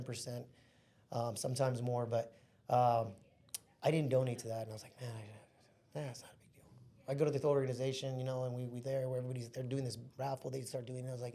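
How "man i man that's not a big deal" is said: soft under-the-breath whisper to himself; whispered inner thought. Retold or imitated. Telling friends his thought at the raffle, whispered to himself.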